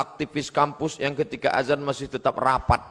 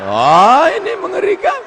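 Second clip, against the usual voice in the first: high, loud mock gasp of horror; hammed-up shock, voice jumping up high; 10 dB louder than usual. Kaget pura-pura soal dosen. (pura-pura ngeri, suara melengking tinggi)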